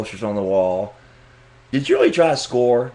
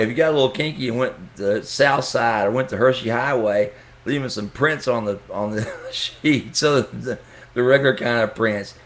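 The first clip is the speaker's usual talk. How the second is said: ordinary delivery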